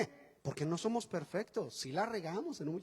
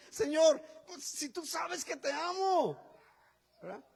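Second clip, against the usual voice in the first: pleading Peter; 7 semitones higher, 4 dB louder, slower than usual. Acted. Peter answering Jesus.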